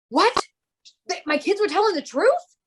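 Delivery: high, loud mock astonishment; shocked disbelief. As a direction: imitate a friend stunned to be proven wrong. Imitates friend stunned kids were right.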